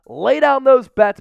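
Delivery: rally the viewers like an announcer; hype call. Real, not acted. Urging viewers to bet on the Super Bowl, loud hype call. Hyping viewers to place bets.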